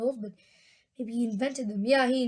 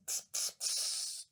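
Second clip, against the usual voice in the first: slow, hushed machine sucking hisses; sucking noise; 12 dB quieter, slower than usual. A narrator's reading. Storyteller imitates the gum machine, soft sucking noise. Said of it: "a sort of sucking noise".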